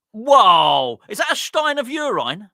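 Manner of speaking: loud whoa, then high-pitched question; startled, voice jumping up high; astonished. Real, not acted. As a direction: exclaim in loud disbelief. Spots guest's huge glass, startled.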